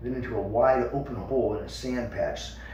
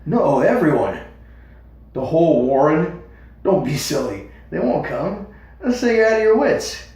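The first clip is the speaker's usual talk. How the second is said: louder, rising alarm; anxious rabbits; 4 semitones higher, 8 dB louder than usual. A narrator's reading. Brothers arguing about the warren, loud. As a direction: argue it out in a hurry. Hazel and Fiver argue.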